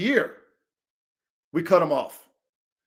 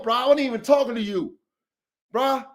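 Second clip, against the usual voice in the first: ordinary talk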